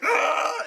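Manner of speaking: high mock scream; hammed-up high yell; imitated yell. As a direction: shriek it like an actor. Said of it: "Toby's like".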